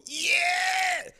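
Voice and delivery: high cheering "Yeah!"; crowd cheer. Retold or imitated. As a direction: whoop like a happy crowd. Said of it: "And people will go".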